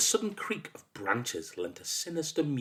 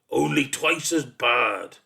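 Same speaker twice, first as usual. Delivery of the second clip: loud, slow, higher-pitched bellow; bellowing monster; 4 semitones higher, 6 dB louder, slower than usual. A narrator's reading. Monster boasting to a boy how bad it is, bellowing. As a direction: bellow it out, swaying ominously. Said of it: "it bellowed".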